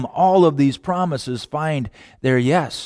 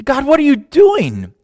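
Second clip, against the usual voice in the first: high, pleading, exasperated questions to God; a child pleading with God, high and exasperated; frustrated child; 10 semitones higher, slower than usual. Retold or imitated. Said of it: "I say, frustrated child".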